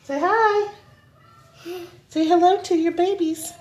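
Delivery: high sing-song coo. Real, not acted. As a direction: talk to the babies up high. Talking to toddlers.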